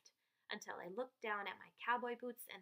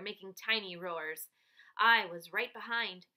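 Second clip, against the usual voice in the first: ordinary delivery, nothing marked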